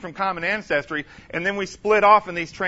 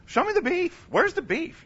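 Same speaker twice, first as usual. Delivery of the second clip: demanding challenge; 10 semitones higher than usual. Acted. Preacher demanding proof.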